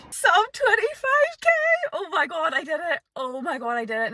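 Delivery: real finish-line elation; elated. Real, not acted. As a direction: squeal the result, then let the voice sink. Celebrating the finished PB attempt.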